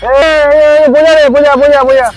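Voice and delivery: loud high shouts; shouting. Acted. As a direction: shout over him. Shouting over someone.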